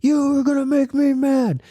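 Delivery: high-pitched mock yell; imitating a yelling kid. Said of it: "yell at the next person".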